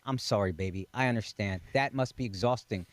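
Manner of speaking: a husband voicing his wife's tender comfort, low; sweet wife voice. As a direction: sweetly soothe him, voice dropped low. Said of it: "I want her to say".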